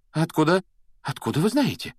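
high, agitated questioning; nervous. A narrator's reading. Shocked professor asks how the boy knows, nervously. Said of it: "нервно выговорила она".

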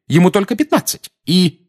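high, loud protest; agitated objection. A narrator's reading. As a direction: protest urgently, pitch well up. Mrs Weasley quarrels, is interrupted. (взволнованно, высоким голосом)

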